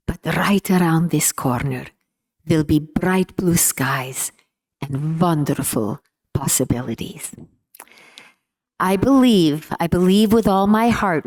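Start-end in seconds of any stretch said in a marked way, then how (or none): 0.1-7.3 put-on Hungarian accent. Daughter quoting her optimistic father, in his Hungarian accent. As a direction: keep up the measured Hungarian accent. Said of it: "in his inimitable Hungarian accent".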